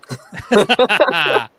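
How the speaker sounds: big, high belly laugh; real, helpless cackle at a crude joke; loud burst of laughter. Real, not acted. Laughs at the wieners line.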